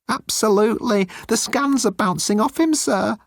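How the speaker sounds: high, timid alien voice; high-pitched nervous alien; Moan's voice. Acted. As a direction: play Moan small and pleading.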